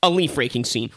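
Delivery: usual speaking voice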